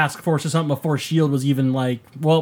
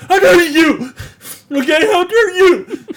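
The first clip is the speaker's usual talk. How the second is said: loud, very high mock-crying yell; overacted crying; 14 semitones higher, 7 dB louder than usual. Mocking Peter crying at his uncle, hammed-up tearful outrage. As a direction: wail it like a terrible melodrama. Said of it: "like, crying".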